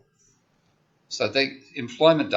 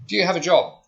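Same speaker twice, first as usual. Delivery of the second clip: higher, louder, mock official asking at the door; surveyor's question; 8 semitones higher, 6 dB louder than usual. Survey caller asks a household about jobs, lightly mimicked, higher and louder. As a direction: play the survey caller and put it brightly to the stranger.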